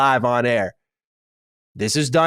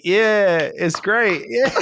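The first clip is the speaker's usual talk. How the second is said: lightly voiced higher, forced agreement; Harvey; 8 semitones higher, much slower than usual. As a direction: play an employee feigning approval. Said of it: "And Harvey's like".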